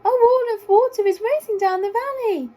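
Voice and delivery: high, loud squeaky warning; squeaky giraffe. A narrator's reading. Giraffe warns the worried animals, squeaky and urgent. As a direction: squeak out the alarm. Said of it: "in a surprisingly squeaky voice".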